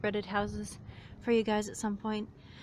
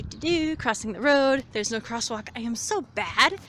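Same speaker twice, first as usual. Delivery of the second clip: normal talking voice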